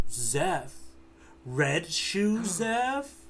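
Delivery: slow, drawn-out disbelief, rising; Don Krieg stunned. Retold or imitated. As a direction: let the name dawn on you slowly. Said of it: "Don Krieg go".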